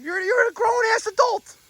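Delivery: very high-pitched mock scolding; exasperated scolding. Boss retelling his scolding of an employee, exasperated. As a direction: pitch shooting up in disbelief.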